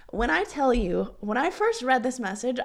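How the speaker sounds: ordinary talk, nothing marked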